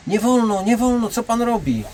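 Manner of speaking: high, scolding protest, lightly voiced; protesting woman. Bouncer voices the woman scolding him, high and indignant. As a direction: play the indignant bystander, lightly.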